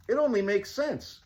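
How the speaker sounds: high, lightly voiced duke; a touch of a pompous duke; character voice. Character voice in story.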